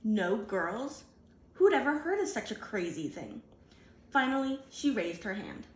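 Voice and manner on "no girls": plain reading voice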